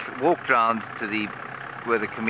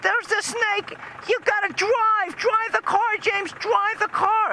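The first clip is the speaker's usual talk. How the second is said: very high-pitched screeching wife; imitated hysterical mother, pitched sky-high; mock panicked scream; slower than usual. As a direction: shriek it in falsetto, hammed-up terror. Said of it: "she just would not be comforted".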